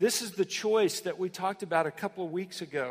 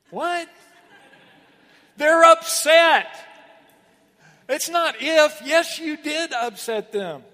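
high, loud mock disbelief, slowing on "They're upset"; incredulous outburst; 9 semitones higher, 13 dB louder, slower than usual. Real, not acted. Pastor scoffing at a non-apology, loud and incredulous. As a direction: react as if the excuse is absurd.

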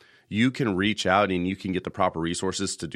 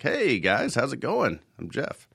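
lightly imitated co-host, higher sing-song greeting; imagined mature co-host; 5 semitones higher, slower than usual. Host voicing how his co-host should greet listeners, mock-mature. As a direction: tease him with a higher mock introduction. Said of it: "would mature and be like".

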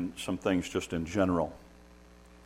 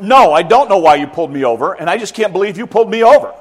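loud, high outraged driver; indignant attitude; 10 semitones higher, 15 dB louder than usual. Retold or imitated. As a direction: huff back at the cop. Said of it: "if you start with the attitude of".

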